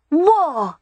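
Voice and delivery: put-on high child's voice, savouring the word; a boy delighted by his game; relishing little boy. A narrator's reading. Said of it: "the boy said with relish".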